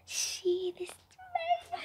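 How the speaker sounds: squeaky high voice. Acted. Sniffing sour candy, squeaky voice.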